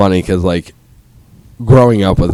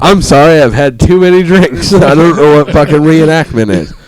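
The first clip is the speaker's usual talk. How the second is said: loud, high, laughing through the words; tipsy, laughing outburst; laughing apology; 7 semitones higher, 6 dB louder than usual. Real, not acted. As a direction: crack up while excusing yourself. Apologising for a drunken word blank.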